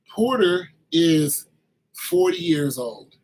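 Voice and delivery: drawn out, each word weighted, "Porter is" stretched; deliberate, slowed restatement for comic emphasis; slow emphatic repeat. Shown as a demonstration. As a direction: spell out "forty years old" slowly, like it's unbelievable. Repeats fact slowly for emphasis.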